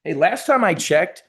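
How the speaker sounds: normal talking voice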